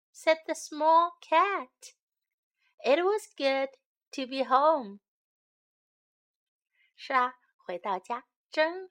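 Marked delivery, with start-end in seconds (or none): 0.1-5.0 gentle storybook reading; singsong storytime narration; reading to children. A narrator's reading. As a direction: read it like a bedtime picture book.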